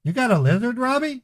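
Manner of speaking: mock-innocent high "Robbie?" with a rising tune; incredulous kid. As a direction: ask it up high like a baffled fourteen-year-old. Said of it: "That's what I said".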